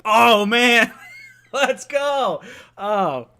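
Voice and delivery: loud, long-held exclamation; overjoyed yell. Real, not acted. Fan host overjoyed at the new coach, loud yell. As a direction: belt it out in sheer excitement.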